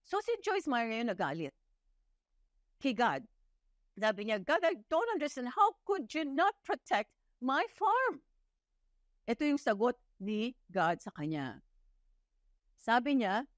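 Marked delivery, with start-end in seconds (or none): 4.4-8.2 higher, pleading complaint to God; upset at God. Retold or imitated. Joyce Meyer complaining to God about her farm, upset and pleading. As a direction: protest to God in mild distress.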